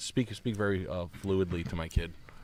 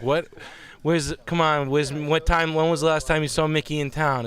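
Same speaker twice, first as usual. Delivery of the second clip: loud, higher tough-cop grilling; mock interrogator; 6 semitones higher, 5 dB louder than usual. Dad voicing his daughter playing interrogator, loud. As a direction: bark questions like a movie detective. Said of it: "She's like".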